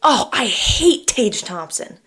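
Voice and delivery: slow, drawn-out groan of dislike; slow mock disgust. Real, not acted. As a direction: drag it out, playfully disgusted. Reacting to co-host's pick.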